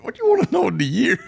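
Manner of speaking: very high, squeaky-pitched disbelief with laughter; mock bafflement. Guest teasing the host over the year, mock bafflement. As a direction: play up being thrown by the question.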